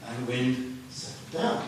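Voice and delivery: ordinary talk, nothing marked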